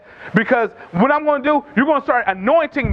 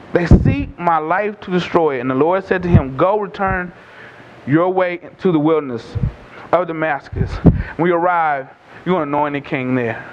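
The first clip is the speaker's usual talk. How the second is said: scripture reading; 5 semitones lower than usual. A narrator's reading. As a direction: read the passage solemnly, pitched well down. Reads scripture to congregation.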